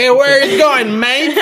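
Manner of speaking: slow, put-on Australian drawl; mock Aussie accent. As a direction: parody a rough Australian bloke. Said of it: "falan filan diye konuşan tipler". (alaycı Avustralya aksanı)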